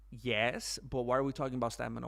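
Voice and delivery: normal talking voice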